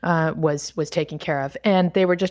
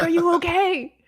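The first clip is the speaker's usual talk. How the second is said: higher, lightly voiced worried official; concerned embassy caller; 11 semitones higher than usual. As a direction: ask like a caller who has been searching for you. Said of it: "They're like".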